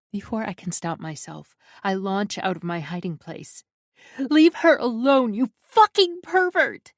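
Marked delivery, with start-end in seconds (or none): 4.2-6.9 high, loud battle scream; furious scream. A narrator's reading. Narrator screams at Mikhail to leave Valeska, high and loud. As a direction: charge in screaming.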